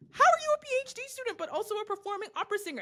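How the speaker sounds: high, quick mimicked question; mock incredulous questioners. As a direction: rattle it off high like amazed fans. Imitates people questioning her sister.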